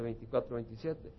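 ordinary delivery

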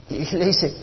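ordinary talk

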